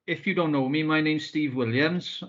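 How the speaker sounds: normal talking voice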